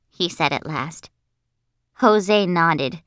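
usual speaking voice

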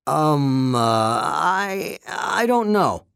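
nervous, halting stammer. A narrator's reading. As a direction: stumble over the words nervously. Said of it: "the man stammered".

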